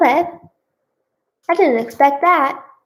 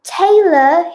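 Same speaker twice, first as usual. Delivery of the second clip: loud, high sing-out of a name; calling out; 6 semitones higher, 7 dB louder than usual. A narrator's reading. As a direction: call to someone elsewhere in the house. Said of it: "he called again".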